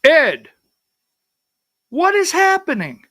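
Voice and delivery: mock-bewildered caller demanding answers; frantic caller. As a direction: imitate a panicked friend phoning. Caller retold again, pleading.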